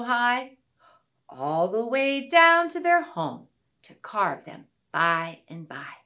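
sing-song rhyming recital; poem recital. Acted. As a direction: read it like a children's poem. Finger play poem recited.

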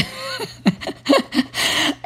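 soft unvoiced laugh; breathy laugh. Real, not acted. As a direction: let out a breathy chuckle. Laughs at herself.

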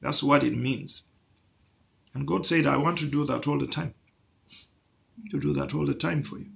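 ordinary talk

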